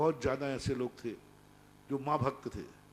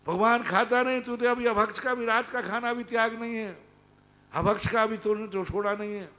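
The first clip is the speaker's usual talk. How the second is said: higher and louder scolding sermon; fiery preaching; 6 semitones higher, 9 dB louder than usual. Acted. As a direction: thunder at the foolish crowd. Preacher scolding at full force. (ऊँचे स्वर में प्रवचन की फटकार)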